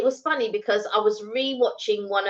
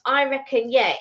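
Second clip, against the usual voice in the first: ordinary talk, nothing marked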